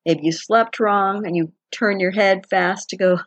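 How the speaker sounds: ordinary talk, nothing marked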